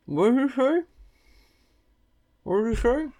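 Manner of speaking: shouting through a mouthful; mouth full. Real, not acted. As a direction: yell with food in your mouth. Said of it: "shouldn't speak with my mouth full".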